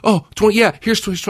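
high, loud eager grab; mock excitement, jumping at the deal. Buyer eagerly accepting a cheap price, high and loud. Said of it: "And you're like".

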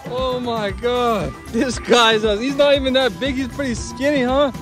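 ordinary talk, nothing marked

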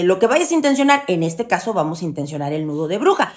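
ordinary talk

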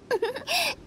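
a quick embarrassed giggle; sheepish laugh. Acted. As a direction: laugh at your own eagerness. Sheepish about her eagerness.

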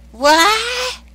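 high loud startled shout; shocked what. Acted. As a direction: blurt it out in shock. Shocked at marriage news.

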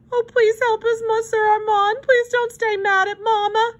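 put-on high, wailing child's begging; weeping plea. A narrator's reading. Girl begs hobo to help her family, weeping. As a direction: beg in a thin, tearful high voice. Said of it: "Susie wept".

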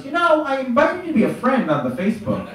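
lightly voiced elderly mom. Mother calling her son about Facebook, sweet and high. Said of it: "she goes".

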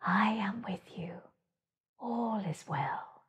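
soft, gentle whispered voice; the moon speaking tenderly, barely voiced; sweet whisper. A narrator's reading. The moon introducing herself to Hare, whispering. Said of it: "said a sweet voice".

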